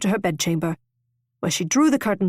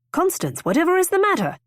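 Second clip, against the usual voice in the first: much higher, concerned male voice; 10 semitones higher than usual. A narrator's reading. Rosecroft meeting weeping Constance on the landing, concerned. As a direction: ask her gently what's wrong. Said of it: "At his concerned tone".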